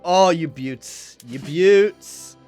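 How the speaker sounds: loud, high, drawn-out cheer; slow, loud, elated praise; delighted exclamation. Real, not acted. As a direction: crow with delight, leaning on the long vowels. Delighted at game allies' moves.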